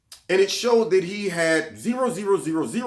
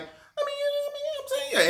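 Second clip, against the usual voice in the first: mocking liberal pundit; 5 dB quieter than usual.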